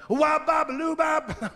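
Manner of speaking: mock rock and roll wail. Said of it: "unnerved my father".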